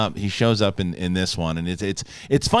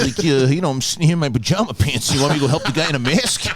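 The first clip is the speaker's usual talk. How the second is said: mock New York worker; 3 semitones higher than usual. Voices lazy New York worker.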